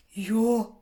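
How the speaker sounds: an amazed, loud "Yo."; stunned yo. Real, not acted. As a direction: let it burst out, floored. Stunned by friend's remark.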